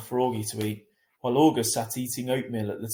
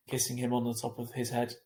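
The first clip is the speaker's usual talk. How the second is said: ordinary delivery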